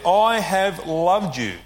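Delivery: slow and weighty, I stressed; emphatic declaration. Acted. Preacher voicing God, slow and emphatic. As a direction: lay down each word slowly. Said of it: "makes this emphatic statement".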